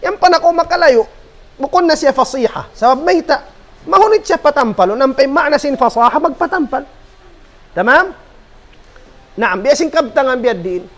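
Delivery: normal talking voice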